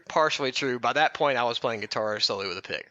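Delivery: ordinary talk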